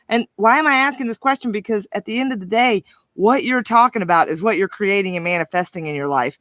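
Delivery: ordinary talk, nothing marked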